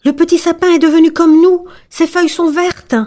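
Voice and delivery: high, quick delighted voices; trees exclaiming. A narrator's reading. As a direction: welcome the fir as one of them. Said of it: "Les arbres s'exclamèrent". (exclamation joyeuse, aiguë et rapide)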